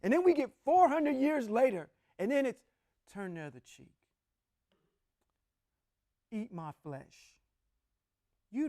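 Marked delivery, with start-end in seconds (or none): 3.1-7.3 mild soft contrasting voice. Retold or imitated. Preacher contrasting Jesus's words with Yahweh's, soft and meek. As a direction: hush it to mock a meek other voice. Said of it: "two distinct people talking".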